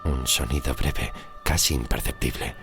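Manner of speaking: ordinary delivery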